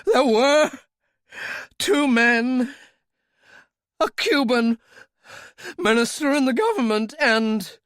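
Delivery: high, loud panicked telling; desperate pleading. Acted. Royale convincing Talbot, high and loud in desperation. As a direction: pour it out to save his life.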